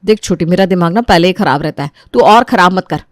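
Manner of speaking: mildly snappish sister-in-law; irritated elder daughter-in-law. Acted. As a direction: warn her off, then defend yourself.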